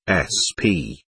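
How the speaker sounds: ordinary delivery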